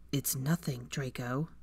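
soft, final denial; quiet. A narrator's reading. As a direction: say it quietly and firmly. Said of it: "Harry said quietly".

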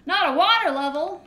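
loud, high groan of dismay; dismayed shout. Real, not acted. Dreading the water section.

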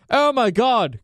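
high-pitched hammed-up panic; mock alarm. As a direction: cry it out like a melodramatic emergency. Mocks overreaction to barbecue smoke.

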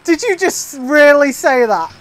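ordinary delivery

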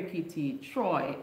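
ordinary talk, nothing marked

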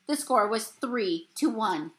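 plain reading voice

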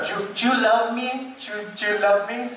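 mock Mexican girlfriend accent; girlfriend's Spanish accent. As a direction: beg sweetly in a thick Spanish accent. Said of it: "didn't speak very much English".

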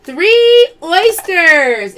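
slow showy live-sale call; drawn-out callout. Acted. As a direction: stretch the words like a reveal. Calling out pull in live sale.

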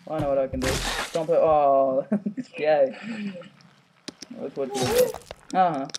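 normal talking voice

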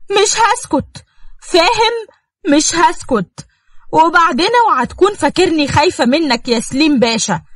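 high, fierce refusal; defiant outburst. Acted. Nour defies Selim, fierce and high. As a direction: throw it back at him.

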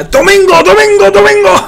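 very high, loud hype shout; monster truck ad. Retold or imitated. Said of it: "Spanish language monster truck commercials".

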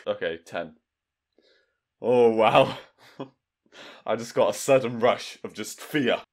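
normal talking voice

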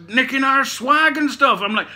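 normal talking voice

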